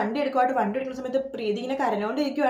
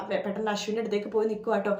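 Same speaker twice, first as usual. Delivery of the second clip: ordinary delivery, nothing marked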